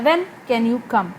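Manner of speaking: slow, "when" stressed, tone falls on "can you come". Shown as a demonstration. Teacher demonstrating stress on "when" with a falling tone. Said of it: "When पे तो मैंने ज़ोर दिया, can you come पे मेरी tone falling है".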